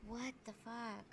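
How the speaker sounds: muffled echoing line, far off; as if talking from underwater; cave echo. Real, not acted. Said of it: "sounds like we're underwater".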